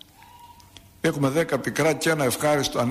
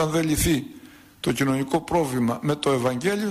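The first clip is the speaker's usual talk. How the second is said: ordinary delivery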